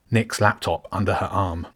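usual speaking voice

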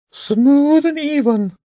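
very high, slow pigeon voice; bishop bird. Acted. Bishop bird guides James's quill, high bird voice. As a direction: coach slowly in a loud, high bird voice.

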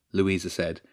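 ordinary delivery

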